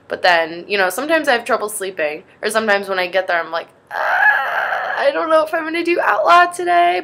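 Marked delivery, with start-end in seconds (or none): 3.9-7.0 mock whiny dread. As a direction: whine it like a dreading kid. Dreading the workout, voiced reluctance.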